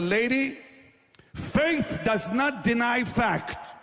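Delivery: high, slow angry shout at the lady; angry rebuke. Retold or imitated. Preacher rebukes the woman denying her cancer, shouting angrily. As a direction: slam each word down, furious. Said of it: "then I got really angry".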